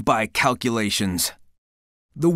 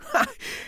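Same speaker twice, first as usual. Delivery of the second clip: short proud laugh; 7 dB quieter than usual. Acted. Laugh between boastful lines.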